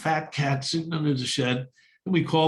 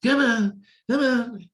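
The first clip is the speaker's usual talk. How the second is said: dad coaxing; 9 semitones higher, 3 dB louder, slower than usual. Retold or imitated. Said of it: "He throws it in there".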